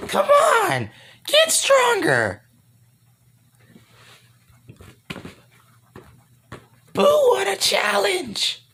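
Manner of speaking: very high, slow squealing taunt; hammed-up high creature challenge; Boo. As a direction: goad them in a scratchy cartoon monster voice. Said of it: "That fool sounds so scratchy".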